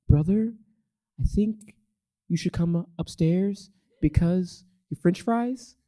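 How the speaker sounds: a hint of a sister, calmly telling her brother the fries are burning; too-calm sister. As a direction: mimic a calm little sister reporting a fire. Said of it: "she's way too calm".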